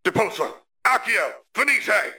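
three high, urgent incantations; shouted spell series. A narrator's reading. Sirius chains spells against Rodolphus, high cries. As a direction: fire the spells off one after another.

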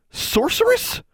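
very high, rising disbelief; incredulous echo. A narrator's reading. Alexandra repeats the boy's name for her, incredulous and high. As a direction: echo the insult in high-pitched affront. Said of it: "Alexandra repeated".

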